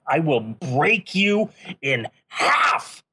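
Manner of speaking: drawn-out roaring threat; slow howling threat. Acted. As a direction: stretch it into a wild howl. Threat repeated, slower, crying out.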